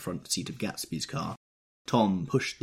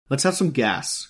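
rough, louder call; 8 semitones higher, 8 dB louder than usual. A narrator's reading. Tom roughly calling to Wilson for gas. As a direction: bark for the gas. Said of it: "cried Tom, roughly".

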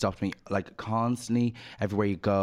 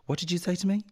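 higher, sharp demanding challenge; confronting mom; 10 semitones higher, faster than usual. Retold or imitated. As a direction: turn back and demand an answer. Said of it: "walked back and said".

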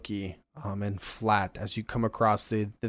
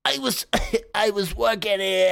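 dying guard; 10 semitones higher, 3 dB louder than usual. Acted. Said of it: "and goes".

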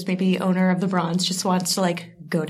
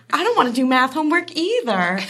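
put-on higher sulky teen voice; whiny teen; 7 semitones higher than usual. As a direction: pout like a bored teenager. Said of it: "I was like".

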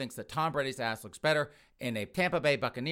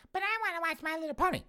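put-on very high, quick child voice; whining little kid; faster than usual. Kid begging to keep watching cartoons, whining little kid. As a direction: squeal it like a pleading small boy.

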